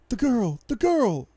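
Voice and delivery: high, loud, urgent cries; sudden piping up. A narrator's reading. Captive woman on the ground interrupting, high urgent cry. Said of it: "Elizabeth suddenly pipes up".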